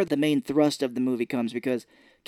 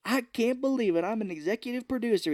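higher, giddy quote; excited; 9 semitones higher than usual. Retold or imitated. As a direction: gush like you just got the job. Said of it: "they're like".

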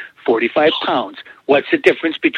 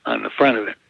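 ordinary talk

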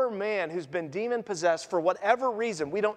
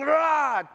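higher-pitched wild yell, acted; raving roar; 5 semitones higher than usual. Preacher imitating the possessed man meeting Jesus, raving yell. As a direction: let out a short madman's roar. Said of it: "he's stark raving".

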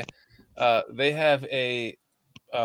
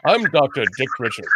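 a little louder, pompous pitchman voice; mock ad pitchman; 5 dB louder than usual. Host imitating his own commercial doctor, a hint of a pompous pitchman. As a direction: announce yourself like a cheesy TV doctor. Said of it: "that's that voice you use right there".

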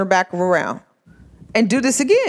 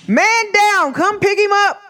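mock call for help; 11 semitones higher, 3 dB louder, slower than usual.

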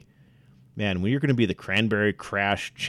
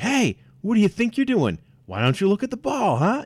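much higher, strained mock berating; berating coach yell; 11 semitones higher, 3 dB louder than usual. Shown as a demonstration. Coach berating a player in front of fans. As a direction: show the bad way to yell. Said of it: "Don't yell like".

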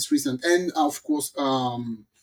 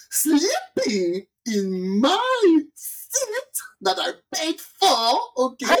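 indignant; 7 semitones higher, much slower than usual. Retold or imitated. Outraged retelling of seat theft.

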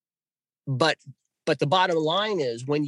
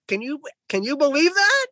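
amazed; 12 semitones higher, 3 dB louder than usual. Retold or imitated. Asking wife, amazed.

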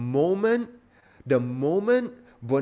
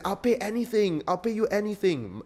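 higher, eager pleading; protesting plea; 9 semitones higher than usual. Retold or imitated. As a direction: beg the Don to name his price. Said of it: "Bonazera protests".

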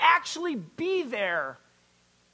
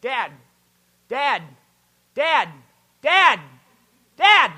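put-on pestering child; 11 dB louder, slower than usual. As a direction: keep calling, building from plain to a shout. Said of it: "it'll go like this".

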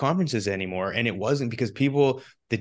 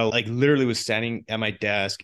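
ordinary delivery

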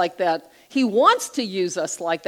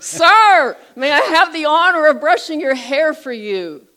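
near shout, much louder and higher; almost yelling; 10 semitones higher, 12 dB louder than usual. Retold or imitated. Woman nearly yells her offer at a deaf old man, loud. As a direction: call it out so a deaf man hears. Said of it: "this time she's almost yelling".

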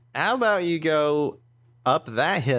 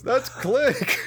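high-pitched starstruck cry; excited fan; 10 semitones higher than usual. Acted. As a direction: pitch leaps up in recognition. Spectator recognises a famous racer.

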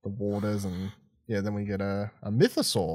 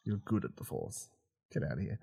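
disgusted mutter; 2 semitones lower, 10 dB quieter than usual. Retold or imitated. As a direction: drop the voice in disgust. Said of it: "I was like".

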